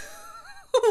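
shrieking burst of laughter; high squealing laugh. Real, not acted. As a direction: let a high giggle burst out. Laughing at the twist.

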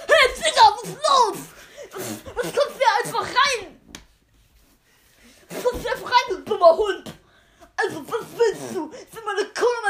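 high, loud, laughing granny; grandma voice. Acted. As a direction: yell it as a rude granny. Grandma voiced in silly story.

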